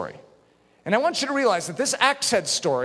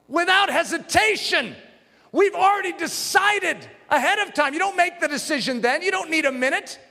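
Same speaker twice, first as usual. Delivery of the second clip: preaching at full force; 10 semitones higher than usual. Real, not acted. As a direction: drive it home to the church. Preaching climax.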